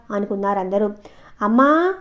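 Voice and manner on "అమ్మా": calling out loud and high for mother; a loud summoning cry. A narrator's reading. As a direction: shout it across the room. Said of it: "గట్టిగా పిలిచింది".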